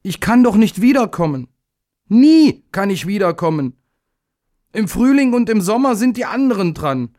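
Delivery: put-on high, pleading child; desperate little day. A narrator's reading. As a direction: plead with rising despair.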